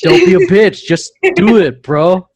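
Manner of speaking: high, loud hype shout; booming motivational speech. Pretend. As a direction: pump them up like a coach. Echoing the advice, shouting.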